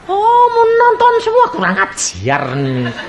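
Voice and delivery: high indignant outburst, then a grumbled laugh; annoyed preacher. Retold or imitated. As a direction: scold the gawking crowd. Preacher annoyed at watching crowd. (pendeta kesal pada jemaat yang menonton)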